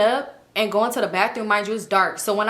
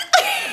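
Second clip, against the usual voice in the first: high squealing laugh; real high laugh; burst of laughter. Real, not acted. Laughing at friend's question.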